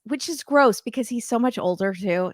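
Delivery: ordinary delivery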